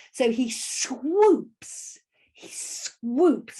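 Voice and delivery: "swoops" stretched long in a gliding swoop; slow, elongated "swoops"; drawn-out swooping. Shown as a demonstration. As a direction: let "swoops" slide slowly up and down. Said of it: "I'm gonna say it like that".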